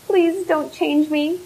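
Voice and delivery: high, loud, slowed pleading cry; crying plea. A narrator's reading. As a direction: beg for mercy in terror, voice climbing. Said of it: "Gundax fell to the ground, crying".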